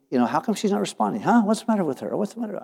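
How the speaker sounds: a hint of an exasperated husband; mock frustration. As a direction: act out puzzled annoyance, lightly. Frustrated when wife won't answer.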